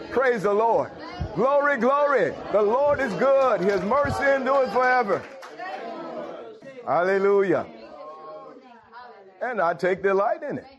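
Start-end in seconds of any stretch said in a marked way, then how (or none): 0.1-7.7 rising, fervent praise cry; preaching praise. Real, not acted. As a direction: lift it up like a preacher at full force.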